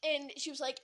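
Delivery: usual speaking voice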